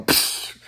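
a quick unvoiced scoffing psh; dismissive psh. Girl waving off a guy's holler with a scoffing psh. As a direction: brush him off with a breathy psh. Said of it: "she go".

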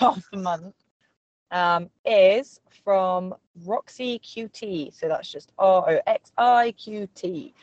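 ordinary delivery

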